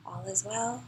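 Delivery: softly murmured affirmation; soothing mantra. Shown as a demonstration. Calming the body on another breath, soft soothing mantra.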